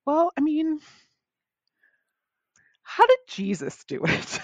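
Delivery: high-pitched mock pondering; sarcastic self-debate. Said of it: "sarcastic kind of debate with myself".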